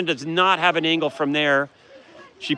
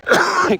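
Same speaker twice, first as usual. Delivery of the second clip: a real dry cough from yelling; hoarse cough; 12 semitones higher than usual. Real, not acted. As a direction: cough from a worn-out throat. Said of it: "Sorry, I'm coughing".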